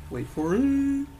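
stretched, rising drum-roll tease; milk the suspense on a high held note; drawn-out suspense. Acted. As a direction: climb high and hold the last word. Second, bigger build-up to the price.

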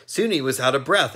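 plain reading voice

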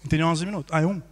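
ordinary delivery

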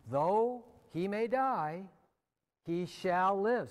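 slow triumphant proclamation; proclaiming. Acted. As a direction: ring out the promise to the hall. Sermon climax on the promise.

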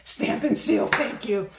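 loud high burst of recall; excited. Real, not acted. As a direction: blurt it out, finally remembered. Remembering the product name.

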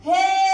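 loud sustained "haaa" on ها; long vowel. Shown as a demonstration. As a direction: hold the "aa" of ها to show the madd. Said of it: "دي عندي مد الف". (مدّ الألف في ها بصوت عالٍ)

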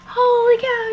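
high, loud exclamation; loud, high gasp of delight; surprised. Real, not acted. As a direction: blurt out in delighted shock. Reacting to more pockets.

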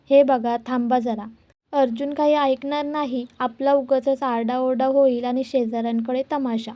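ordinary delivery, nothing marked

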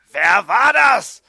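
very loud, high, drawn-out yell; frightening, slow roar of a question; full scream. A narrator's reading. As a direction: scream it at the top of your voice. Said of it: "schrie ich so laut".

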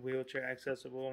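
usual speaking voice

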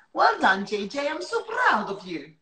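a light Welsh try at Well done, J J; gentle Welsh line read as a retake; Welsh Gavin and Stacey line. Acted. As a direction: Welsh accent, half-hearted. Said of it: "That was quite good".

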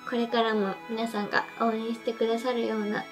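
ordinary talk, nothing marked

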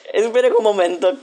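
mucho más agudo, entre risas; sorpresa. Real, not acted. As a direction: frena en seco, sorprendida y riendo. Reacción a algo inesperado. ("espera un momento" agudo, entre risas)

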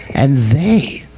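whisper. Acted. Whispered aside in the narration.

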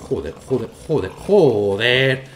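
slow, drawn-out exasperated cursing. Real, not acted. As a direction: drag out the swearing in frustration. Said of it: "cursing at the game". (maldiciendo con rabia)

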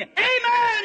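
high, loud Amen; joyful shout. Acted. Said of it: "he said".

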